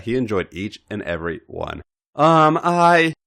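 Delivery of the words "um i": high, hesitant stammer; flustered stammer. Acted. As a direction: stumble over the words.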